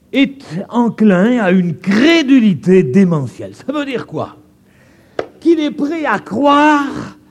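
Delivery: preaching at full force. Acted. Preacher denouncing credulity to the congregation, full-force preaching. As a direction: thunder it from the pulpit.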